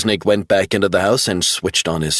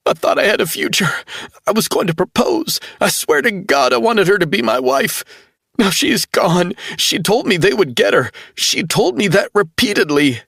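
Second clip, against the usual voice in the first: much higher, tearful and rushed at first; crying; 13 semitones higher than usual. A narrator's reading. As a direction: weep through it, barely holding on. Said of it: "began to cry again".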